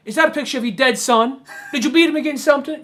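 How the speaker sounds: high, quickening needling questions; mock berating. Kid goading a mother about her baby's picture, mock needling. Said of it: "he just keeps on berating".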